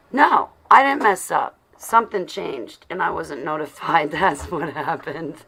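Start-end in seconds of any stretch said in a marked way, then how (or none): 0.1-1.6 indignant surprise. Real, not acted. Discovers the time changed.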